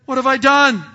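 loud, stunned cry of panic; panicked outcry. Retold or imitated. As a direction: blurt it out in sudden alarm. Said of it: "I said".

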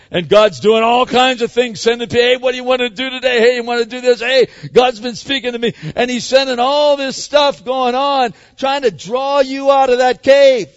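loud, driving sermon voice; preaching at full force. Acted. Pastor preaching hard to his church, loud and driving. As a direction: preach it out to the congregation.

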